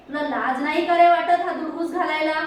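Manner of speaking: mildly angry telling-off; angry mother. A narrator's reading. Mother scolds son for the ruckus, mildly angry. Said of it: "आईला राग आला".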